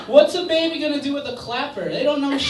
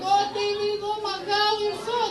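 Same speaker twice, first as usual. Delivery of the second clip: excited parents; 10 semitones higher than usual. Retold or imitated. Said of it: "they're Colombian".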